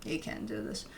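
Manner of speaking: low, soft, discouraging inner voice; dismissive inner voice. Retold or imitated. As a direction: voice the mind talking you out of it. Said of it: "the mind has the opportunity to come in and tell you".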